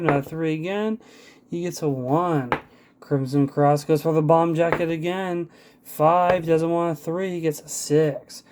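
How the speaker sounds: live ringside play-by-play; wrestling commentator. Acted. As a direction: call the match blow by blow. Play-by-play of dice wrestling match.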